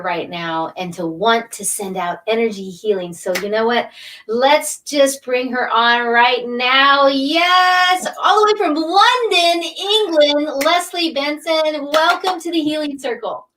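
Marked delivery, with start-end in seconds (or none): 4.3-13.5 excited guest introduction. Real, not acted. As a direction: bring the guest on with a big lift. Host introduces and welcomes guest.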